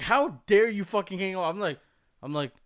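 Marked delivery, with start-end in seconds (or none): 0.0-1.4 high, loud mock outrage yelled down the phone; louder, higher imitation of an angry stranger; furious caller. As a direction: yell it like an enraged caller who was hung up on. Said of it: "whoever's on the phone is pissed".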